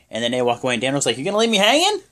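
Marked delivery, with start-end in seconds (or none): 1.2-2.0 high, loud rising question; mock protest. Daniel calling after departing wrestlers, high mock protest. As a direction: imitate someone calling out as he's left behind. Left behind, calls after them.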